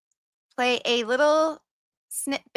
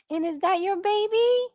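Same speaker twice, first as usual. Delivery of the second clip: cooing question; 11 semitones higher, 3 dB louder than usual. Real, not acted. Asking a viewer about the baby in her picture, high cooing voice. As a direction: coo over the photo.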